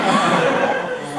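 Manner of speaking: loud, drawn-out gasp; mock horrified gasp. Character who hugged a bunny to death, mock horrified gasp. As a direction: gasp in exaggerated shock.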